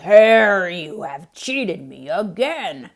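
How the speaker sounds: loud, gruff accusation; scowling bear. Acted. Bear accusing Hare of cheating, gruff and loud. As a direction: bark the name, then grumble the charge. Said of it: "Bear looked at his pile and scowled".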